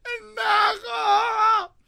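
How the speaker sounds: high, loud, slow tearful insult; woman yelling at her prankster husband, with a high tearful mock scream; mock furious yell. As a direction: scream it through fake tears.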